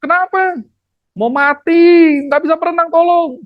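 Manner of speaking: high-pitched mock drowning shouts; acted cry for help. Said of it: "yang di laut itu teriak". (pura-pura teriak minta tolong, nada tinggi)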